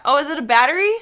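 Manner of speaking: excited. Real, not acted. Delighted surprise.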